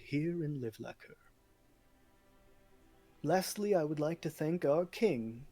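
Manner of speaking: lordly speech to the feast hall, rising on safer; proud nobleman addressing the king's court; noble's speech. Acted.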